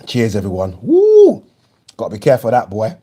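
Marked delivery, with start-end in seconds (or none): 0.8-1.4 sudden, very high, loud whoop after the shot; burning-drink woo. Real, not acted. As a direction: whoop as the rum burns. Reacting to the strong rum.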